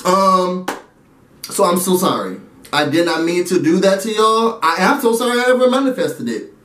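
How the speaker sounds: usual speaking voice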